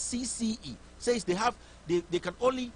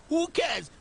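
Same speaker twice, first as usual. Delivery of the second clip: scornful shout; 9 semitones higher than usual. Real, not acted. Host shouting down the report on air, scornful yell. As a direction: shout it down with contempt. Host dismissing Akufo-Addo prediction.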